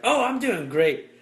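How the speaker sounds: high, loud, slow fake-cheerful "doing great". Mocking people who claim to be fine, fake cheer. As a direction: oversell the happiness. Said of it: "they're like".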